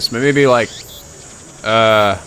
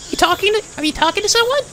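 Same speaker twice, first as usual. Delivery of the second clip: put-on cutesy girl voice. Girl character questions him.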